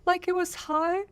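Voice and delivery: demonstrating a voice stuck high, yodel-like; high strained voice. Shown as a demonstration. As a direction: voice jumping way up high and tight. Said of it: "I was kind of talking like this".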